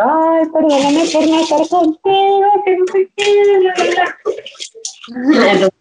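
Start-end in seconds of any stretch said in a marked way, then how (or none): 0.0-4.2 slow, higher, tearful plea mimicked; imitating crying sister. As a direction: play the weepy sister apologizing from afar. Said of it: "ella llorando".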